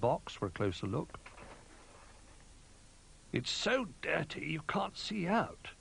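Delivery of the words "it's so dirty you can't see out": slow, high little bear. A narrator's reading. Little Bear peering through grime, slow and high. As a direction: draw it out in a small voice.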